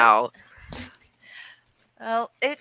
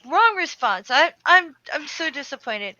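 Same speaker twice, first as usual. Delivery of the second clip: louder, higher mock scolding; mock indignant; 6 semitones higher, 5 dB louder than usual. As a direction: play the let-down girlfriend. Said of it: "And I was like".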